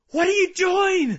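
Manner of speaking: high, very loud yell; acted scream. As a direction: scream it as a starving spirit demanding an answer. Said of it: "bang on the door of your heart and scream".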